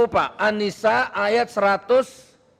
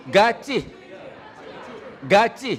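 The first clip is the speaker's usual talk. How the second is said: ordinary talk, nothing marked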